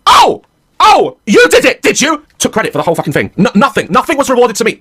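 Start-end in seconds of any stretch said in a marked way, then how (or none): 0.1-2.3 higher, louder sarcastic oh, oh; mock disbelief. Dad reacting to his daughter taking credit, high and sarcastic. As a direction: feign surprise at the lie. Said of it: "I was like".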